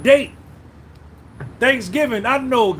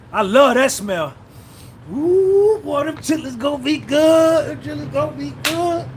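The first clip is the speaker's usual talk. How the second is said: put-on a higher, delighted "Ooh, boy" over the chitlins; savoring the smell; 6 semitones higher than usual. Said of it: "you be like".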